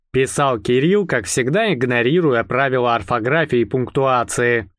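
ordinary delivery

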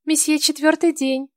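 high, thin maid calling; thin female voice. A narrator's reading. Maid's voice.

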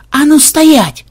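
high loud yell to stop. Acted. Xenobyte yelling at the brawling crowd to stop, high and loud. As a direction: bellow the order to freeze. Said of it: "завопил один из них".

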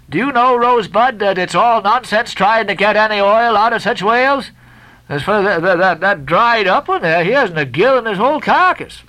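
jest, then drop confidentially; teasing sailor. A narrator's reading. Stubb teasing the angry Guernseyman.

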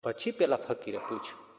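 usual speaking voice

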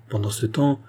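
usual speaking voice

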